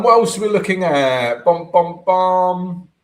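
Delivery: usual speaking voice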